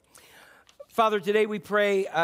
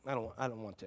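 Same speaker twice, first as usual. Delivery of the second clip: reluctant mumble; 7 semitones lower, 11 dB quieter, faster than usual. Retold or imitated. Voicing reluctant self-talk.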